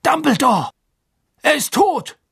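high-pitched cry of alarm; panicked, high exclamation; horrified cry. A narrator's reading. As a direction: cry out the news in shock. Fudge discovers the dead boy.

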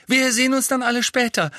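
a mother beside herself with pride, gushing high; giddy mother. A narrator's reading. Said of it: "Ach, ich bin ganz hibbelig".

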